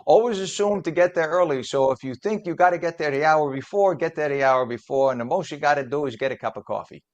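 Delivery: put-on Italian New York dad voice; imitating a father in an Italian New York accent. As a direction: give the advice as an Italian New Yorker. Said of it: "give him an Italian New York accent".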